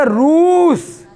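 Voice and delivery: रूस with the ū stretched out long and high; long oo in Roos. Shown as a demonstration. Teacher drawing out रूस to show the long vowel. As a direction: draw out the ū of रूस for the class. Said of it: "हमने क्या बोला था?".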